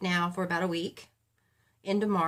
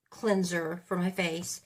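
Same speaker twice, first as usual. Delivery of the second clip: ordinary talk, nothing marked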